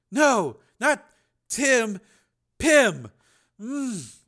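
slow, high, laboured correction; halting sedated boy fading to a mumble; thick, slurred voice. A narrator's reading. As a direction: correct him groggily, then drift off. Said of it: "His voice was thick and slurred".

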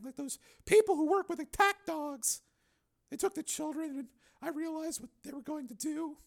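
a wounded woman remembering; Donna. Acted. Donna recalling the attack to Steele, bitter.

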